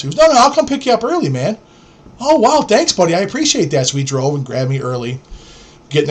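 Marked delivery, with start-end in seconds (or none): 0.2-1.6 high, quick, over-friendly buddy; eager offer. Retold or imitated. 2.2-3.9 high-pitched, impressed thanks; mock grateful. Acting out thanks for a ride.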